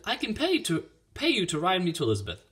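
put-on high, eager girlish offer; high-voiced appeal for a favor; pleading girl. A narrator's reading. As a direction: pitch it up and make your case. Girl bargains with farmer for ride.

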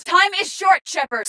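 high-pitched call to hurry; urgent urging. Acted. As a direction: urge the commander on under pressure. Urging commander in combat.

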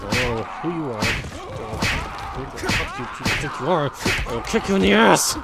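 building to a loud, fast threat; hammed-up rising rant; mock aggression. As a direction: fake tough-guy outburst.

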